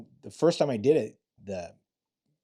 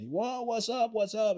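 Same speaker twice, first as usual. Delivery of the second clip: high-pitched "what's up" street greeting; undercover phone greeting; 11 semitones higher, slower than usual. Retold or imitated. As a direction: greet a caller like an old buddy, pitched way up. Answering bad guys' calls undercover.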